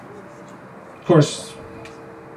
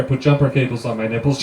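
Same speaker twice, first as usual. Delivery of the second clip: ordinary talk, nothing marked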